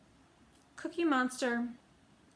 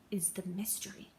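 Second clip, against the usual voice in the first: secretive whisper; 8 dB quieter than usual. Acted. Detective reveals the mystery.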